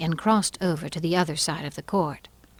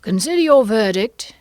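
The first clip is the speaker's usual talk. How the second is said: loud, higher royal order; commanding king; 5 semitones higher, 9 dB louder than usual. Acted. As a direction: proclaim it to the jury as king. King orders jury to decide.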